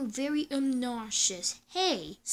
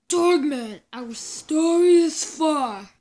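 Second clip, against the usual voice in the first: booming title announcement; dramatic announcer voice; 5 dB louder than usual. Acted. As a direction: announce it like a big show.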